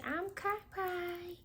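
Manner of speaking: put-on high, soft little baby voice; coaxing baby voice; baby's voice. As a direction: speak for the baby in a tiny high voice.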